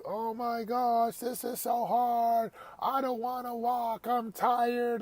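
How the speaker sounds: put-on high, slow whining child; whiny kid imitation. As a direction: whine it out like an exhausted kid dragging his feet. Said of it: "he was complaining like you could not believe".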